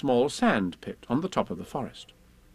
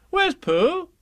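put-on high, louder boyish question; young Christopher Robin; 5 dB louder than usual. Christopher Robin at the door asking, boyish high voice. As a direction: ask eagerly as a boy.

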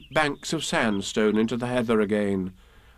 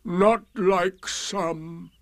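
very slow, higher doleful drone; Eeyore, reproachful; 6 semitones higher, much slower than usual. A narrator's reading. Donkey grumbling about others, slow and doleful. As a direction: drag out the grievance. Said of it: "he said".

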